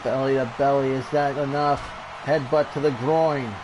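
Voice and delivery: lively ringside wrestling call; energetic play-by-play over a brawl; play-by-play commentary. Acted. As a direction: call the match like a TV announcer. Live wrestling game play-by-play.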